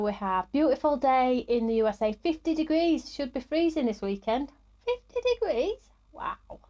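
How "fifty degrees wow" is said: high, amazed question; can't believe it's that warm; surprised. Real, not acted. Reading a viewer's weather report, real surprise.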